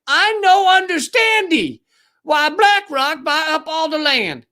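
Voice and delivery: high, slow mock dim-witted voice; dumb-guy voice. Comedian mocking a TV host as a simpleton, high and slow.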